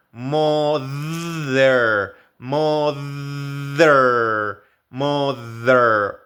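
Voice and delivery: mother with a buzzing voiced th. Shown as a demonstration. Teacher drilling mother with a voiced th. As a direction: model mother slowly, vibrating the th. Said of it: "En la sonora hay palabras como mother".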